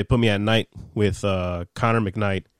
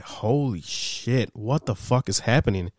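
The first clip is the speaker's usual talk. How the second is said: stunned; 5 dB quieter, slower than usual. Retold or imitated. Retold stunned reaction to rant.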